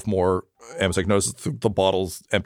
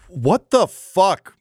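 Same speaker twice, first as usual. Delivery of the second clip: high, drawn-out exasperation; appalled outburst; 9 semitones higher, much slower than usual. Acted. Duke reacting to the confession, appalled. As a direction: stretch it out in disbelief, pitch up.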